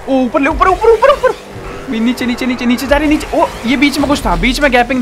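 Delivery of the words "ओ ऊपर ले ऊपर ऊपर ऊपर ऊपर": high, louder urging cries; will the car up; excited urging. Real, not acted.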